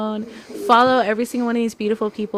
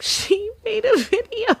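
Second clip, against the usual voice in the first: much higher, slow and giddy; giddy disbelief; 13 semitones higher, 3 dB louder, slower than usual. Retold or imitated. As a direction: squeal it out in happy disbelief. Retelling call to Alea.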